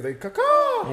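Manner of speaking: high, cawing crow imitation; teasing crow sound; mock crow call. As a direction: caw it out like a bird. Bird women calling back.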